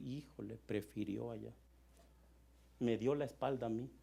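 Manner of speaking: low, hushed hurt; hurt and subdued, imagining God starting to cry; God near tears. Acted. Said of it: "Me imagino a Dios empezando a llorar". (Dios dolido, a punto de llorar, en voz baja)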